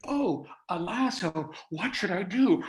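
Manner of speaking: theatrical clown dismay, rising to a high cry; clown reacting. Clown caught by a lasso in his bit, clownish alarm. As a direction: play exaggerated clown panic, building. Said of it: "was reacting like a clown".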